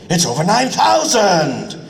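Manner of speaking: a longer, drawn-out second take; stretched take. Shown as a demonstration. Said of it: "You're gonna have to stretch it out".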